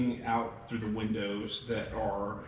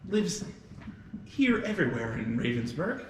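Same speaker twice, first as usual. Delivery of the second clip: high, loud fussing hostess voice; a motherly innkeeper all in a flap; flustered innkeeper; 10 semitones higher, 3 dB louder than usual. Acted. Lydia explains the orphan girl to the guests, high and flustered. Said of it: "And Lydia goes".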